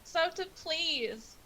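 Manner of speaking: high, drawn-out desperate plea; young girl crying out for help, not full volume; mock scream. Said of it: "she screams again".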